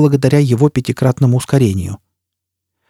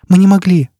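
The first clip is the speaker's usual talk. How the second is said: cornered blurt; 6 semitones higher, 4 dB louder than usual. Acted. Forced confession under torture.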